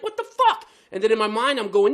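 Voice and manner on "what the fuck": high, loud retold yell; exasperated shriek, acted out; cussing out. Driver cussing at woman cutting in, high outraged yell. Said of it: "I start cussing".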